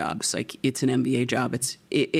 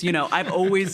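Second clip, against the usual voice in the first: usual speaking voice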